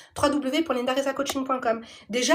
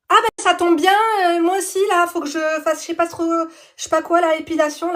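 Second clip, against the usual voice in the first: higher, louder bubbly friend imitation; mock friend voice; 5 semitones higher, 6 dB louder than usual. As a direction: play the chirpy friend, hammed up. Friend voiced in retold call.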